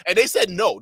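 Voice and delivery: normal talking voice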